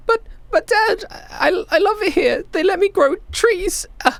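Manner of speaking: put-on high-pitched tearful kid; confused little kid near tears; upset child. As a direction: protest to Dad in a high, whimpering child's voice.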